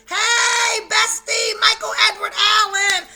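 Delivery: very loud, high, slow call of welcome; thrilled shout. Real, not acted. Host spotting her friend join the stream, shouting happily. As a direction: shout for joy as a friend arrives.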